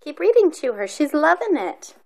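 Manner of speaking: excited. Real, not acted. Excited encouragement of siblings.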